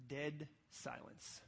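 ordinary talk

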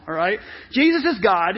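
ordinary delivery

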